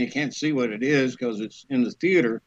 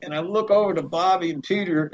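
normal talking voice

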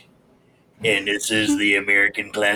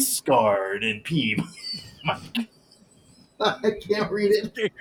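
laughing; 9 semitones higher, slower than usual. Real, not acted. Breaking up while reading.

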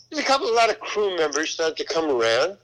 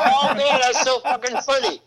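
high and loud, laughing through the words; imitating crew cracking up; 8 semitones higher, 4 dB louder than usual. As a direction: let the held-in laugh burst out. Crew bursting out after holding laughter.

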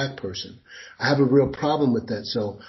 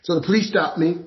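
normal talking voice

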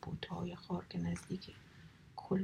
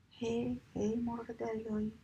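mock call across the shore, a bit higher; 3 semitones higher, slower than usual. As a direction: call out to wake the seagull. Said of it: "بعد شروع به فریاد زدن کرد".

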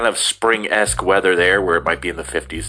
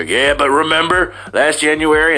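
put-on higher, louder old-pal enthusiasm; mock excited reminiscing; 9 semitones higher, 5 dB louder than usual. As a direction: play a friend eagerly recalling last winter. Acts out old friends swapping weather memories.

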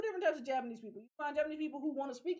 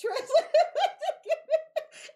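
high, loud laughing through words; real laughter breaking in; 12 dB louder, slower than usual. Real, not acted. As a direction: laugh at the absurdity.

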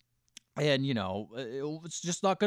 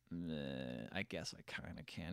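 put-on very low, quiet, half-hearted drunk; barely bother to agree, sunk down low; wasted reluctance; 9 semitones lower, 18 dB quieter, slower than usual. Drunk Jet answers the challenge to fight, low and listless. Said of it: "Jet's just wasted".

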